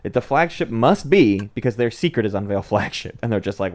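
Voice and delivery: ordinary delivery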